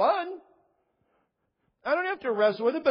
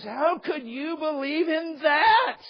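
incredulous student; 7 semitones higher, 4 dB louder, much slower than usual. Retold or imitated. Student voiced in retold debate.

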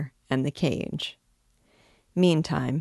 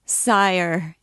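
desperate calling cry, lifting and louder; a hint of a despairing cry; 3 semitones higher, 4 dB louder than usual. A narrator's reading. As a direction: call after the departing king in despair. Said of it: "he cried in tones of despair".

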